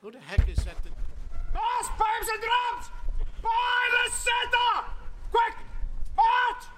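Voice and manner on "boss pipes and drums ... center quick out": high shouted call. Acted. Pipers arrive at studio door.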